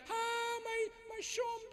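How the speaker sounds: high, soft fake lament; mock wailing. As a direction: pretend to weep for a stranger, hammed up. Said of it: "दूर जाके करती". (नकली रोते हुए ऊँची धीमी आवाज़)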